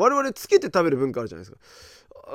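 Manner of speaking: normal talking voice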